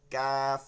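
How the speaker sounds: "ক্যাফ" long with a thin level vowel; light and thin. Shown as a demonstration. Teacher contrasting with the heavy letter, thin. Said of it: "হালকা plus চিকন".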